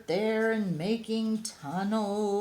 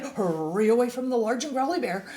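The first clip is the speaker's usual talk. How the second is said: hurried cry; 5 semitones higher, 6 dB louder, faster than usual. Acted. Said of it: "he cried".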